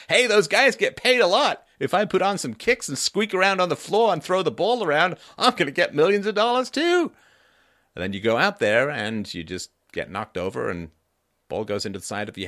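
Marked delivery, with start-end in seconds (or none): 0.1-7.1 loud, high, cocky voice of a dreamer; naive wannabe baller. Pretend. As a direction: brag like a guy who thinks it's easy money. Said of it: "You know, like".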